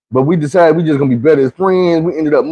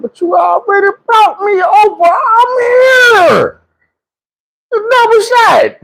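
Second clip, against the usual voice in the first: high protesting yell; slower than usual. Retold or imitated. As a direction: cry out in mock outrage. Pleading with her loudly.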